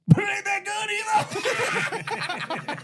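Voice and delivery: drawled big-guy deadpan, high and laughing; imitating the huge guy's slow verdict; slow drawn-out punchline. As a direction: stretch it out slowly, cracking up.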